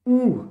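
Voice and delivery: "Ou" sung out high and loud, like a call; modelled vowel. Shown as a demonstration. Teacher answers his own question for the class: a high, bright "Ou". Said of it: "Donc là, on lit comment".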